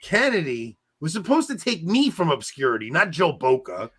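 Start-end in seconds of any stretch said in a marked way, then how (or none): none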